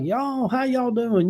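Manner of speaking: Southern greeting. Retold or imitated. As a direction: stretch it out like a friendly Southern politician. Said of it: "he speaks Southern language".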